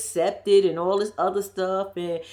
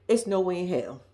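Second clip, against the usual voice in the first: ordinary talk, nothing marked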